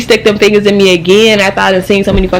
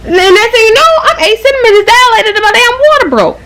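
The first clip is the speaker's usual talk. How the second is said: excited outburst; 13 semitones higher than usual. Real, not acted. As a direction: blurt it out in high excited disbelief. Excited climax of birth story.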